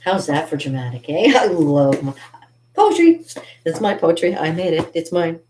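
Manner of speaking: ordinary talk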